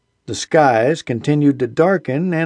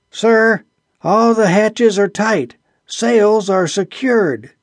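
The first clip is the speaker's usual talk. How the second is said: shouting over machinery; 6 semitones higher, slower than usual. A narrator's reading. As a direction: shout it over roaring engines. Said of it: "Ardley's vibrant voice crackled from the volume of his yelling".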